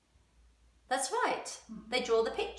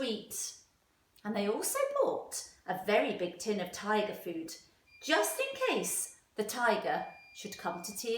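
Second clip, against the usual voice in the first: lively, expressive storytime voice; animated picture-book reading; reading to children; 3 semitones higher than usual. A narrator's reading. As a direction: read it aloud to a class of young children. Teacher reads story to class.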